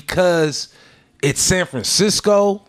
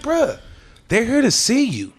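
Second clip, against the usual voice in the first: retold exhortation; 7 semitones higher, slower than usual. Urging a performer he retells.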